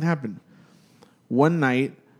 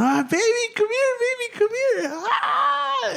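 much higher, panicked crying call; imitating the screaming aunt; slower than usual. Imitating the aunt crying out for her kids, high panicked shout. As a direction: mimic a mother shrieking for her baby in fear.

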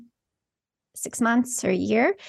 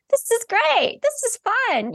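high, bright-eyed delight; high gleeful quote; 11 semitones higher than usual. Retold or imitated. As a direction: squeal it out like a thrilled kid. Quoting own delight while channeling.

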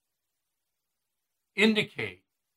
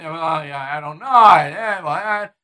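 stammering, evasive mumble; lightly voiced fumbling witness; mocking a flustered Mueller. Host mocking Mueller dodging questions, flustered stammer. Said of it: "if you ask him a question".

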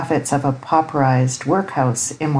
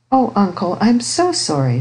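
put-on a touch of apology in a higher girl's voice; lightly voiced sorry niece; 5 semitones higher than usual. A narrator's reading. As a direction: apologise to an uncle, pitched up a little. Niece apologises to vexed uncle.

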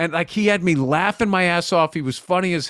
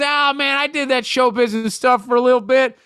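lightly voiced Southern cabbie, pitched up; a hint of mock Southern, higher; Southern accent; 7 semitones higher than usual. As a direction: imitate a Southern accent with a high voice. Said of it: "the most hardcore Southern accent".

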